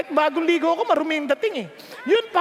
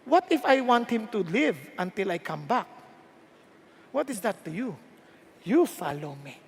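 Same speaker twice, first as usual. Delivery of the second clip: lower, softer voice of God; quiet lowered God voice; 7 semitones lower, 5 dB quieter than usual. Retold or imitated. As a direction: speak as God, calm and low. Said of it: "Ang sabi ng Diyos".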